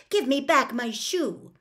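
put-on mildly angry little girl; understated indignant demand. A narrator's reading. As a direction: demand it back with a touch of anger. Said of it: "grew angry and said to the witch".

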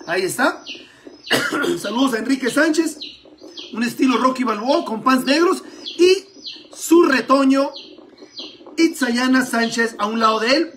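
loud, high sports commentary; live play-by-play. Acted. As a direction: call the race live and excited.

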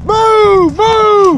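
loud urgent command; shouting. Real, not acted. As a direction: bark it to push the squad. Leader urging squad forward.

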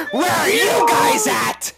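manic Joker yell. Acted. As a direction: scream it like an unhinged clown. Joker yelling at laughing strangers.

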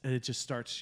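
ordinary delivery

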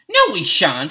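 defiant call, voice lifted; shouting. A narrator's reading. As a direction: shout so a deaf man hears. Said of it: "shouted Jack, determined to make the old deaf man hear".

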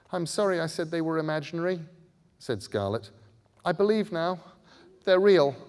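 high, rushed, rising to loud; fast frightened girl. A narrator's reading. Scarlet admitting ghosts are real, rushed and scared. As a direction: blurt the apology then insist.

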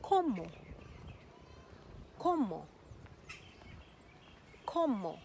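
repeated model "Cómo", falling from a strong CÓ; stress on the O. Shown as a demonstration. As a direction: hit the first syllable of Cómo. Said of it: "that's where we put the emphasis".

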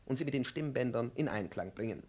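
ordinary talk

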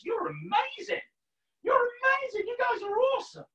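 put-on high, gushing cheer to the kids; excited praise. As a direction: cheer them on, bubbling over. Praising the audience.

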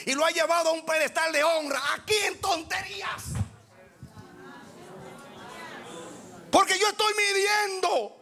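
a fired-up preacher pleading with the church; fiery preaching. Real, not acted. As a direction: raise the voice and drive each question home. Preaching at full force.

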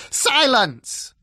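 piercing, high eagle screech; high raw screech. A narrator's reading. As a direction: cut the squabble dead with a screeched command. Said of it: "high and raw, screeched".

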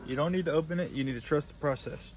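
ordinary talk

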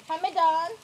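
loud high greeting. Real, not acted. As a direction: call out loudly to the pet. Greeting the dog again.

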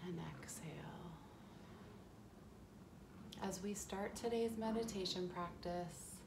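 calm, unhurried guiding into the breath; guided meditation. Acted.

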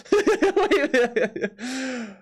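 slow it down, breaking up laughing. Real, not acted. Streamer to chat, cracking up laughing.

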